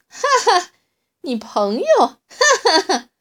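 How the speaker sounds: high, loud mocking laughter; big laugh. A narrator's reading. T-rex mocking the little dinosaur, roaring with laughter. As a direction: laugh at him till it hurts. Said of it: "乌提莫哈哈大笑起来".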